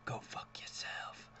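breathy, soft under-the-breath jab; whispered aside. Real, not acted. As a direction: hiss it as a private aside. Muttered aside after the quote.

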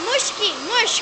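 high, loud sing-song pet name; loud pet-name calling. Real, not acted. Big sister calling the crying toddler's pet name, loud and high.

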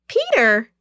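acted joyful cry of recognition; joyful shout. A narrator's reading. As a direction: call it out in delighted surprise. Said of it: "she shouted joyfully".

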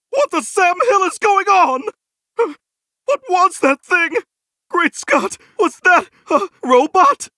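high-pitched, incredulous questions; astonished. Acted. Character spotting a strange robot, astonished and high. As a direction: exclaim in amazement, pitch jumping up.

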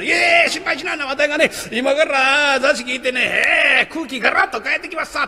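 eager reassurance. Acted. Jester promising the mother he will lift the mood. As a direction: cheerfully brush off her worry.

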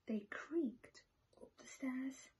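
sneaking whisper; whispered narration. A narrator's reading. Narrator whispers as the animals sneak in. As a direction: whisper it like creeping in.

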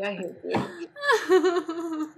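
slow, drawn-out laughing jab; laughing mock hate. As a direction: tease through laughter. Mock complaint while laughing.

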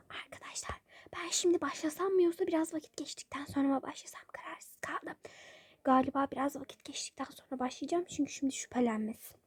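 hushed plotting whisper; whisper to camera. Real, not acted. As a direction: whisper the plan so nobody hears. Whispering plan to viewers secretly.

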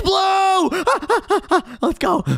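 high, loud burst; excited shout. Real, not acted. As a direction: shout it out in triumph. Racing game, calling the answer.

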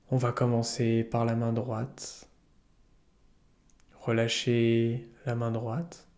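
calm yoga nidra guide, unhurried with long pauses; soothing meditation voice; guided meditation. Acted. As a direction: lead listeners gently into relaxation, leaving space between phrases.

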